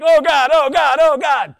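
high, very loud panicked scream. Pretend. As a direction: scream like the plane is going down.

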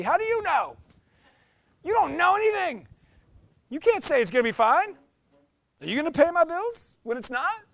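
high, loud indignant rant; mock-furious rant at a hollow reassurance; mock outrage. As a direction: tear into the falsely cheerful friend. Ranting at falsely reassuring friend.

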